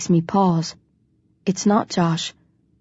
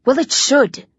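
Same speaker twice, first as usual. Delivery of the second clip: sharp angry snarl, higher; 6 semitones higher than usual. A narrator's reading. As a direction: snap it back angrily. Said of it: "I snarl".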